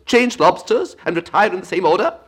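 loud, high Gryphon call; calling out steps. A narrator's reading. Gryphon calls the quadrille steps, loud and high. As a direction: call the figures like a dance caller, loudly.

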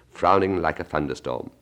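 ordinary delivery, nothing marked

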